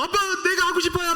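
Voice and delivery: high, fast tearful whine; hammed-up wailing child; imitated crying child. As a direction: plead like a little girl throwing a mock tantrum. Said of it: "막 울면서".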